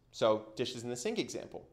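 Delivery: ordinary delivery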